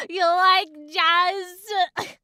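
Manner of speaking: loud, slow, high put-on voice; attempted movie-line impression. Shown as a demonstration. Reader overdoes the film bee's line, loudly. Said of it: "trying to do a good impression of it".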